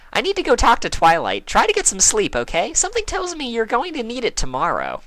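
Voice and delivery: cheerful pony telling a friend to rest; Pinkie's voice. A narrator's reading. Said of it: "Pinkie said".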